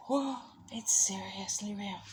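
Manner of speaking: breathy whisper of amazement, imitated; awed whisper. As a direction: hush it like you can't believe it. Retelling amazement at the tree.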